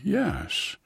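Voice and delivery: shy murmur; whispered answer to teacher. A narrator's reading. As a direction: murmur it shyly. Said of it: "murmured shyly".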